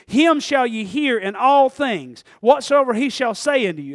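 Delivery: normal talking voice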